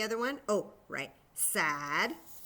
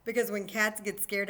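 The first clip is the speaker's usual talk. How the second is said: ordinary talk, nothing marked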